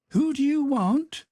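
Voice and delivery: put-on high, guarded old-woman voice; wary old housekeeper. A narrator's reading. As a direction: challenge the stranger at the gate. Housekeeper calls through the gate.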